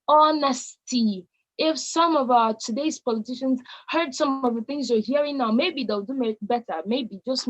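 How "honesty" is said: honesty drawn out slowly for emphasis; stress on honesty. Shown as a demonstration. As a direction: stretch out honesty, giving each syllable weight. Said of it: "Allow me to stress and emphasize on that word".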